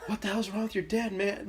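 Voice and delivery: high-pitched incredulous imitation; baffled teammate. Teammate asking about friend's dad, high and baffled. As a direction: put on a high, disbelieving voice.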